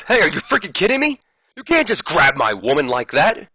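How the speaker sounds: high, loud indignant wrestler; mock outrage. Saturn defends his mop as his woman, mock outrage. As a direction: play up the jealous outburst, pitched high.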